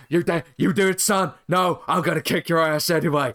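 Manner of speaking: tough-talking wrestler's threat, pushed up; mock a belligerent wrestler picking a fight; punchy, hammed-up brawler voice; Stone Cold Steve Austin. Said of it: "Stone Cold addresses his enemies".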